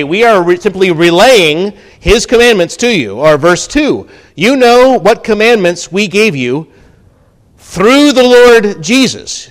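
high, loud, ringing proclamation; full-force preaching. Acted. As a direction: hammer it out to the congregation.

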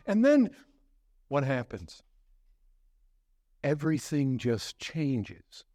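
ordinary talk

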